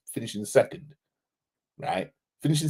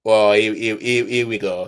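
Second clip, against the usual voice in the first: loud, lower call to attention; 2 semitones lower, 7 dB louder than usual. Real, not acted. Streamer calls chat to attention, loud. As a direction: announce the next one out loud. Calling out a new comment.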